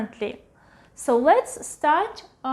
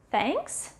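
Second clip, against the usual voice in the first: ordinary talk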